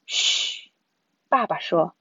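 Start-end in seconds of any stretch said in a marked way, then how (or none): 0.1-0.7 drawn "shh" hush; shushing. A narrator's reading. Father hushing the family to listen, shushing. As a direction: hush everyone with a soft shush.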